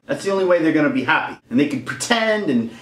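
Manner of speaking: ordinary delivery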